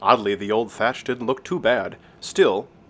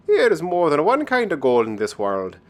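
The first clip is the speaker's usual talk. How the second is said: higher-pitched Irish sprite voice; wise leprechaun; 6 semitones higher, 4 dB louder than usual. Acted. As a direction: impart a lesson as a wee leprechaun. Leprechaun imparts his lesson.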